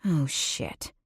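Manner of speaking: a muttered grumble under the breath. Acted. Narrator grumbling to herself while drying her hands at the sink. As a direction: grumble it to yourself, annoyed. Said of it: "I grumble".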